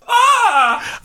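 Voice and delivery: high, drawn-out "ah"; mock cry of alarm; shouted cry. A narrator's reading. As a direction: let out a high theatrical wail. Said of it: "The man with the exotic accent shouted".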